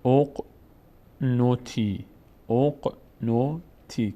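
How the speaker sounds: «اق نوتی» very slowly, opening on short o. Shown as a demonstration. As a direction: sound out «اق نوتی» in separated syllables. («اق نوتی» شمرده، با ضمه در آغاز)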